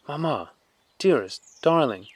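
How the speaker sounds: put-on a small boy calling out in joy; joyful shout. A narrator's reading. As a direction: son throwing himself on his mother. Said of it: "he shouted".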